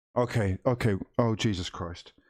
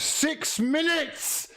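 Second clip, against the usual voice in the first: drawn-out shout; 13 semitones higher, much slower than usual. Real, not acted. Counting down the clock.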